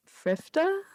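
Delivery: normal talking voice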